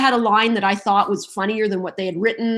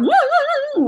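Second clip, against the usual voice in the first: high woo warm-up sirens; vocal warm-up. Retold or imitated. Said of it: "I would walk around going".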